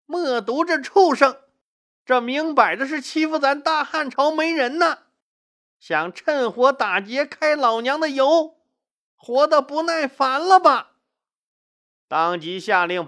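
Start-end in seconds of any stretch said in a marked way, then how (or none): 0.1-10.9 high-pitched furious tirade; angry cursing. A narrator's reading. Empress dowager tearing up the insulting letter, angry cursing. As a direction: curse him out in outrage, voice pitched way up. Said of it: "大骂道".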